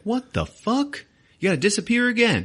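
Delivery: mock-exasperated girlfriend, voice shooting up; incredulous outburst. Liz snapping at Peter for running off, high incredulous outburst.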